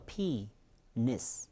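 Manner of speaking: "penis" slow and split, long bending PEE, then short -nis; long vowel bent. Shown as a demonstration. Teacher repeats it step by step, slow long-vowel "penis". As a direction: stretch and bend the first vowel of "penis", softly. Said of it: "장음이는 꺾입니다".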